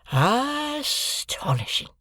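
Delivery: "Astonishing!" high, very slow, falling away; repeated astonishment. A narrator's reading. Joe repeating it in a daze, slow falling "Astonishing!". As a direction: still dazed, letting it sink. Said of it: "there he remained so long, saying".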